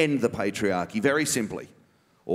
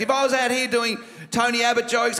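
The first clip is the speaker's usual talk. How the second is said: ordinary delivery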